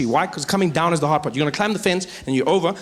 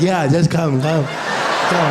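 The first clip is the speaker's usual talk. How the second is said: talking dog; 2 semitones lower, 3 dB louder, much slower than usual. Acted. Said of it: "the dog's like".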